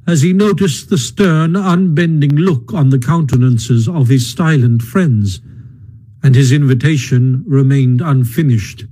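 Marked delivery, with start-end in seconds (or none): none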